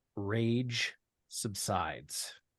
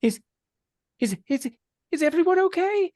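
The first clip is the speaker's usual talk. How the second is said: worried high voice; 7 dB louder than usual. Acted. NPC calls out after battle.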